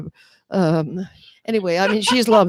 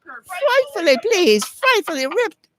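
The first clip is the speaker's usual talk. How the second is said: high, frightfully posh British gush; British accent; 11 semitones higher than usual. Pretend. Guest thanks the host in a mock British accent. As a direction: gush like a flustered English lady. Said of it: "congratulations for doing this, that outstanding, uh, British accent".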